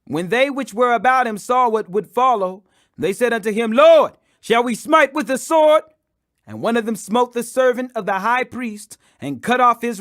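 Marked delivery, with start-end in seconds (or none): 3.7-5.8 urgent cry, much higher and louder; urgent disciples. Acted. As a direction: blurt it out, ready to fight. Disciples ask to fight at arrest.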